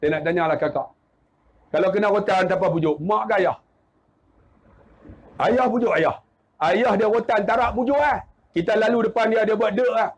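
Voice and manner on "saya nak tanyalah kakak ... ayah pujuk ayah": ordinary talk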